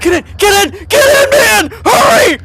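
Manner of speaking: frantic high shout; panicked shouting. Real, not acted. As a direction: yell in real panic. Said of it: "I just heard you panicking".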